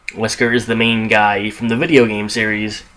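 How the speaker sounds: ordinary talk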